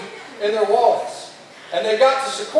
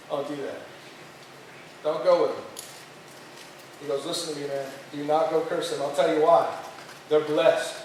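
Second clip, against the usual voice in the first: lightly voiced God, pitched low; 5 semitones lower, 5 dB quieter than usual. God warning the prophet not to curse his people, lightly voiced and low. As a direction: give the prophet a low, restrained instruction. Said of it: "God says to Balaam".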